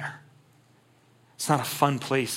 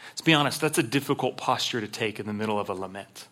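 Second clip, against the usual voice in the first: normal talking voice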